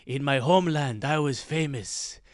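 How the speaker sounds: mock Israeli accent, drawn out toward the end. Comic imitating an old Israeli comedian, mock Israeli accent. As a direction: lament lost fame in a hammed-up Israeli accent. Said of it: "from Isra-, Israel, and he's like".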